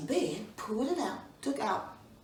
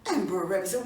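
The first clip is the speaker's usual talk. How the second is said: usual speaking voice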